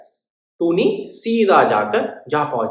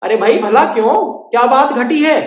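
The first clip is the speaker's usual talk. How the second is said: higher, louder puzzled king; 8 semitones higher, 8 dB louder than usual. Acted. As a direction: ask like a curious king. King asks why.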